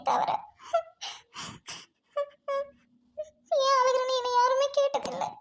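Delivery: tearful, bewildered lament, building in the later lines; crying. Acted. Orphan struck by a stranger resembling her father, crying. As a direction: talk through tears, letting the hurt build. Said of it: "ஏன் அழுகுறீங்க?".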